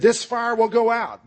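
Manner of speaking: high, sustained proclamation; pitched well up, unflinching; defiant declaration. Acted. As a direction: proclaim it boldly to the magistrate. Polycarp defies magistrate at the stake.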